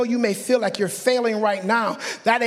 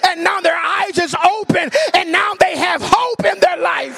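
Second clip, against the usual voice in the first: high, fired-up preacher's shout; carried away with real excitement, stretching into a shout; excited preaching; 7 semitones higher, 4 dB louder than usual. Real, not acted. Preacher telling church how hope returns, excited yelling. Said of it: "I get excited".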